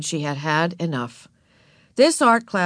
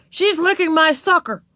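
high, indignant tattling; loud interruption; 9 semitones higher, 4 dB louder than usual. A narrator's reading. Wayne tells on Ramona over his sucker, loud and indignant. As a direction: call out the culprit in a raised boy's voice. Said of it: "interrupted Wayne in a loud voice".

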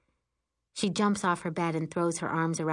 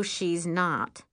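ordinary delivery, nothing marked

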